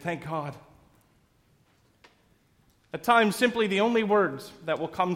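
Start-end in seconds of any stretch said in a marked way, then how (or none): 0.0-0.5 exasperated. Acted. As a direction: Thank God with a weary, fed-up edge. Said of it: "Even feeling exasperated".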